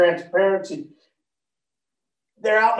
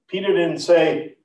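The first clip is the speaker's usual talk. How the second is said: ordinary talk, nothing marked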